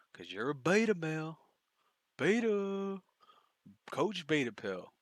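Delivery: high, loud mocking taunt; jeering name-calling. Real, not acted. Commentator jeers at man in clip.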